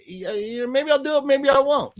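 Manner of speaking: put-on high-pitched comic Italian accent; Italian impression. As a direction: tease in a hammed-up Italian voice. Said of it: "do your Italian impression".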